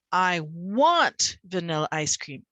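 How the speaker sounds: slow, emphatic swell on "want"; stress on WANT. Shown as a demonstration. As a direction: hit "want" hard and hold it. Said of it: "I'll go through and say".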